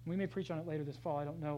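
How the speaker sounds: normal talking voice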